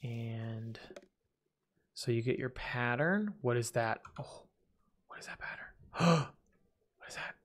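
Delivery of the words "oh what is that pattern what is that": whispered wonder. Real, not acted. Spots odd carpet pattern.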